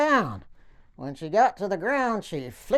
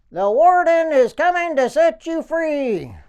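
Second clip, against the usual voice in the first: loud, higher shouted news; yelling up; 8 semitones higher, 7 dB louder than usual. Retold or imitated. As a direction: call it up the tower to the stuck man. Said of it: "So I yelled back to the guy".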